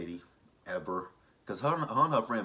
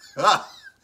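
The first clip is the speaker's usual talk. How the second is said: loud laugh; 7 semitones higher, 11 dB louder than usual. Real, not acted. Vlogger cracking up over Angela, loud laugh.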